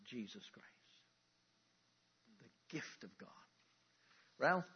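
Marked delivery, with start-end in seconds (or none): 0.1-3.3 reverent whisper. Acted. Hushed reverent close of verse.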